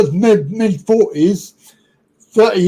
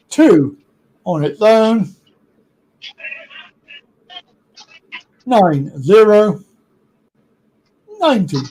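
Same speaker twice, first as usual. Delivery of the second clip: bingo caller; slower than usual. Acted. Calling bingo numbers.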